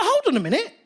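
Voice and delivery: high, rising incredulous indignation; comic rant at the heckler, indignant and high; indignant outrage. Retold or imitated. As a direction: let the outrage climb. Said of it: "I was like".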